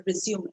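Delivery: Resume as re-zyoo-may; three-syllable Resume, the noun; correct pronunciation. Shown as a demonstration. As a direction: say Resume with its final vowel sounded. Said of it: "this word is pronounced as".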